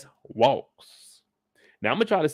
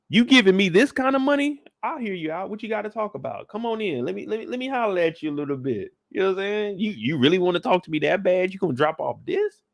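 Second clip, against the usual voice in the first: psychic voiced; 6 semitones higher than usual. Voicing the psychic taking money.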